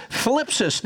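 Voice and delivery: thlipsis as flip plus sis; thlipsis, lisped flip then sis; Greek word thlipsis. Shown as a demonstration. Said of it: "So you got flip and sis".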